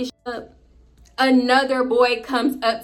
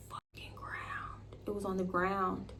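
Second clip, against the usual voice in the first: hushed disbelieving repeat; stunned whisper; 14 dB quieter than usual. Real, not acted. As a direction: let it drop away into a whisper.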